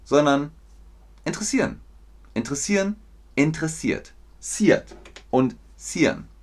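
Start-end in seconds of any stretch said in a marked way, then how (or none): 1.2-4.1 "interessieren" shortened to in-tre-SIAN, higher; street German. Shown as a demonstration. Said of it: "Nicht interessiert oder interessieren, sondern". (Straßendeutsch, „interessiert“ als in-tre-SIAT)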